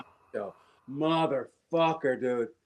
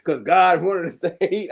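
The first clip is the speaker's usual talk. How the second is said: louder put-on Klansman voice; lightly voiced Klansman, louder; mock Klansman; 2 semitones higher, 6 dB louder than usual. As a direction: ham up a bigot. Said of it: "the Klansman going".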